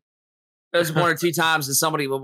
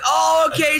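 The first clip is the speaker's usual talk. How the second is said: mimicked grandma; 14 semitones higher, 6 dB louder, slower than usual. Grandma voiced, high.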